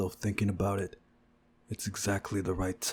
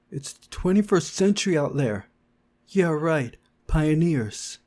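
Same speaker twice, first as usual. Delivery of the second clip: higher, louder scornful jab; scornful retort; 8 semitones higher, 7 dB louder than usual. Acted. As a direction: throw it back mockingly. Mocking loud argument.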